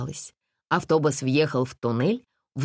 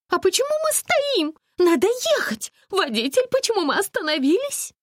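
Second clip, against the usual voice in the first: screeching lady; 4 dB louder than usual. A narrator's reading. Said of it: "завопила дамочка".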